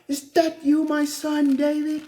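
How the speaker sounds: sugar-coat it, you don't mean it; fake sweet talk. Saul calling out to David, high fake sweetness. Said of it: "The same dude who trying to unalive me".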